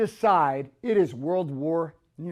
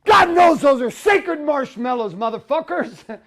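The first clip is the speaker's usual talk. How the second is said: louder, slow, hammed-up fury; mock outrage; 5 semitones higher, 8 dB louder than usual. Man mocking his own rage at teenagers, loud slow outrage. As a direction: rant like the marshmallows were holy.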